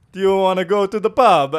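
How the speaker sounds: high, loud cartoon Italian invitation; Italian accent. Mario inviting the storyteller to the pub, loud hammy Italian accent. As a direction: sell it like a jolly cartoon Mario. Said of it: "you gotta do it".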